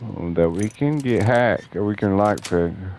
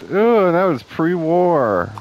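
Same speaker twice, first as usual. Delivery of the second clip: mock impressed ooh; 9 semitones higher, 3 dB louder, slower than usual. Mock awe at old food.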